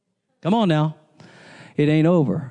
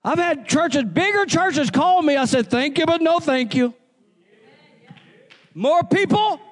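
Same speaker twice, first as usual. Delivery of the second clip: high, fervent sermon climb; rising gospel preaching; 11 semitones higher than usual. Acted. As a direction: preach it hard, calling the church to answer. Preaching continues.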